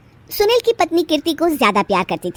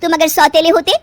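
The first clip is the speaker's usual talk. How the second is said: louder, higher, tearful edge; a wife's louder, higher ultimatum to her husband; angry wife's ultimatum; 7 semitones higher, 5 dB louder than usual. Acted. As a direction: force him to choose.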